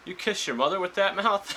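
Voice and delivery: lightly voiced Fury, needling; mock Fury. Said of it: "Fury just says".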